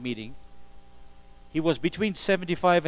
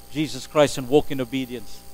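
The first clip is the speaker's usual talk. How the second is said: ordinary talk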